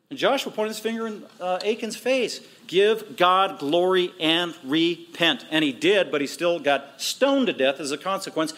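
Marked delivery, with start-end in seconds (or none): 2.7-5.4 slow, weighty pronouncement; solemn command. Retold or imitated. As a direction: deliver it as a slow, stern command, word by word. Joshua commands Achan to confess.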